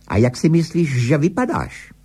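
high, cross challenge; angry calling. A narrator's reading. Incensed wife calls up to her husband, mildly acted anger. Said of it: "volala nahoru na manžela rozezlená".

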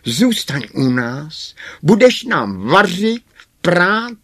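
high, loud pleading dwarf voice; loud, high-pitched dwarf invitation; eager dwarfs. A narrator's reading. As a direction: urge her to stay, pitched high.

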